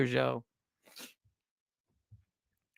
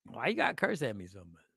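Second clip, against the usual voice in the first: ordinary talk, nothing marked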